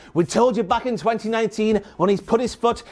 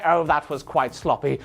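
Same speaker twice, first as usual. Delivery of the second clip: mock prissy critic; 8 semitones lower, 3 dB quieter than usual. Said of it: "and say".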